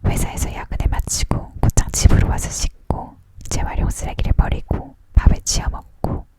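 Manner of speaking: usual speaking voice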